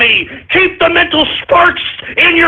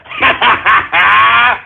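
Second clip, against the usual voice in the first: shouted cackle; 4 semitones lower than usual. Acted. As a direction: laugh in their faces. Gloating at trolls.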